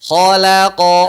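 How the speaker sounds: Khalaqa with heavy kha, thin lam, heavy qaf; loud Khalaqa, lam kept thin; thick kha, thin lam. Shown as a demonstration. Teacher models Khalaqa for students to copy. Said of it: "Kha tebal, lam tipis, qaf tebal".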